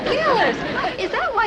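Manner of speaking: indignant exclamation. Acted. Lieutenant catching on to the private's scheme, indignant exclamation. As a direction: pull him up sharply, pitched high.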